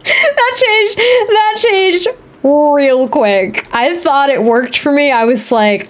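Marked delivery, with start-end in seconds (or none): 0.0-3.7 high, squeaky laugh through the words, slowing at the end; helpless laughter pitching the voice up. Real, not acted. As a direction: try to speak through laughter, drawing out the ending. Laughing as repeats own remark.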